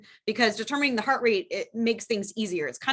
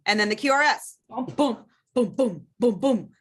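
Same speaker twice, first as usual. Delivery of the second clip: rhythmic booming beats; heartbeat boom. Shown as a demonstration. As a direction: pound out each beat. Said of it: "teacher demonstrates heartbeat rhythm".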